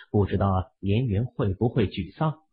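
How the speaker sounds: ordinary delivery, nothing marked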